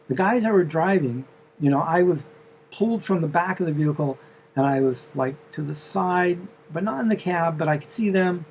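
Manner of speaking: normal talking voice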